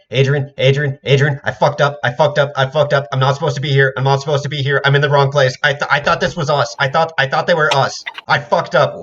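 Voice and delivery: high, loud frantic babble; panicked rambling. Acted. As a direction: beg in a panic. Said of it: "he just spoke in two times speed".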